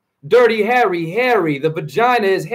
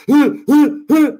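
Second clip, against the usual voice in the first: mock heaving gasps, higher and louder; panting heaves; 6 semitones higher, 4 dB louder than usual. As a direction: strain out each breath as if lifting dead weight. Imitating himself heaving at party.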